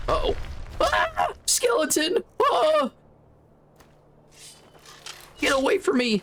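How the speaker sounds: high panicked screams at a skeleton; scared then relieved. Pretend. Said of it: "the bit where I am irrationally afraid of skeletons".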